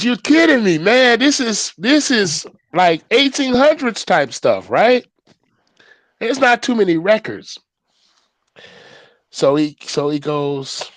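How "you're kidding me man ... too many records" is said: high, loud you're-kidding-me; retelling his thrilled reply to Uncle Butch; excited disbelief. Retold or imitated. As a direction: rave in happy disbelief.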